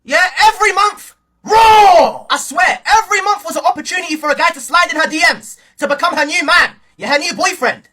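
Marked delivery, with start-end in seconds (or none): 1.4-2.3 loud, higher drawn-out yell; comic shout. Pretend. Joking about a girl's boyfriends, loud comic shout. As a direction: holler it as a crude punchline. Exclaims a crude punchline.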